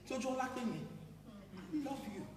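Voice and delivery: very soft, slow declaration of love; soft loving reassurance; tender. Acted. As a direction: murmur it gently to soothe her. Tender reassurance after tears.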